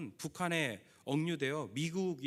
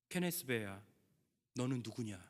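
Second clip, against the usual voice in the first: lower, softer, solemn voice of God; preacher lightly voicing God's commanding call; powerful voice of God; 5 semitones lower, 4 dB quieter than usual. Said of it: "이런 하나님의 강력한 음성이".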